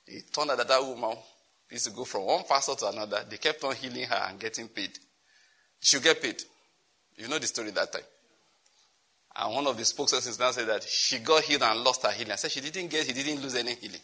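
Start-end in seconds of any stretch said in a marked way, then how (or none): none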